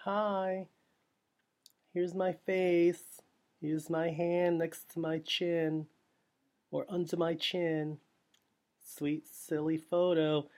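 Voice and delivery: drawn-out, mocking parody of a cheesy pose; mocking posing girl. Mocking a girl posing hand on chin, slow and sing-song. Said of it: "and like".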